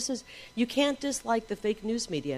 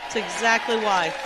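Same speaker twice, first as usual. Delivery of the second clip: rally speech; 5 semitones higher, 6 dB louder than usual. Real, not acted. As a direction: shout it to the crowd. Rally crowd, building chant of reasons.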